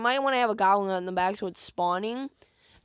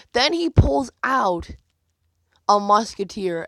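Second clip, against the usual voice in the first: louder and slowed down, drawing out the reveal; dramatic reveal; 7 dB louder, slower than usual. Real, not acted. Gamer telling viewers about the opponent's card, loud slow dramatic reveal. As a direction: build suspense on the card drop.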